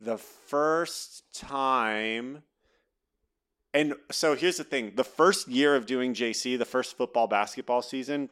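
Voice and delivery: ordinary talk, nothing marked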